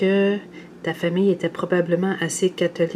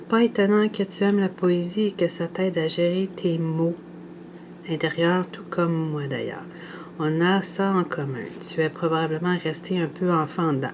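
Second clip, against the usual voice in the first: normal talking voice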